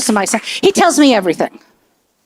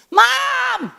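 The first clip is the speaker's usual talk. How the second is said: put-on higher teen calling from far off; son calling out; 10 semitones higher, 6 dB quieter, slower than usual. Teen son calling to mom from the shower. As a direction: call out high as if from the shower.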